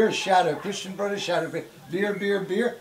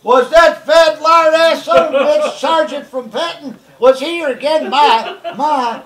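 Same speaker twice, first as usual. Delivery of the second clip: loud, higher, slow furious bellow for Ma; angry yell at mom; 8 semitones higher, 10 dB louder, slower than usual. Retold or imitated. Brother finds the beer gone, yelling for Ma. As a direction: roar it down the hall at mother.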